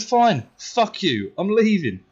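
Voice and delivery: high, drawn-out angry kiss-off; defiant outburst. Retold or imitated. Pupil walking out on teacher, angry and defiant. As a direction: throw it back defiantly while storming off. Storming out in retold row.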